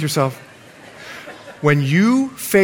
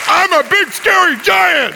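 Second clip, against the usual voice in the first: big scary giant; 12 semitones higher, 3 dB louder than usual. Pretend. Giant challenging Caleb once more, booming mock threat. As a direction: roar it as a pretend giant. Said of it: "I'm gonna be the big, scary giants".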